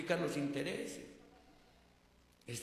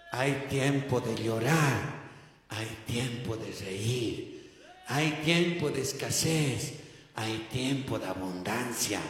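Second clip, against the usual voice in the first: calm assurance; 6 semitones lower, slower than usual. Retold or imitated. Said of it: "Yo les decía".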